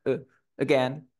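"eh" as a relaxed schwa, then "again" starting on it; schwa demo. Shown as a demonstration. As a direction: begin "again" with a neutral, reduced vowel. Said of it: "Tiene schwa, tengo que hacer". ("again" empezando con schwa)

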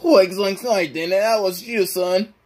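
high cartoon slacker protesting; Shaggy. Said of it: "Shaggy, hush".

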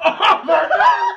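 slow, high, laughing shout of pain. Real, not acted. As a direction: howl it out as the heat hits. The sauce burns his mouth.

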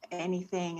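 ordinary talk, nothing marked

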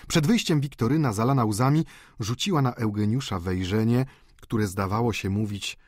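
usual speaking voice

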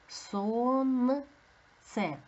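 careful teacher's syllable-by-syllable demonstration; sounded out. Shown as a demonstration. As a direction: draw out СОНЦЕ syllable by syllable. Teacher sounds out word for analysis.

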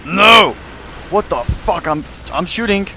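high loud cry of dismay; panicked yell. Real, not acted. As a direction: shout in sudden panic. Panic in firefight.

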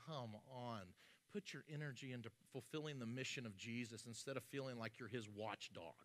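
low, soft mock plea; quiet exasperated aside. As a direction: say it under the voice, as a private gripe. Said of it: "at times I'm like".

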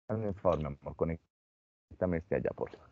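voz apagada y débil de enfermo, mucho más suave; enfermo con gripe. Acted. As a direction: pide favores sin fuerzas, casi sin aliento. Teacher voices a feverish adult. (adulto con gripe pidiendo ayuda a su pareja, voz débil y suave)

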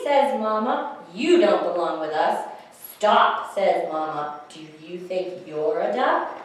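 lively storytime read-aloud; animated picture-book reading; reading to children. Acted. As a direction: read it like storytime for little ones.

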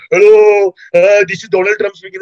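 loud, high put-on caller voice; Donald Trump prank voice. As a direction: ham it up like a prank phone call. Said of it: "we call up with accents".

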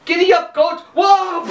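high excited yell; yelling giddy up. Acted. Silly Hans yells high to his billy goat, excited. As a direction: whoop it out high, spurring the goat.

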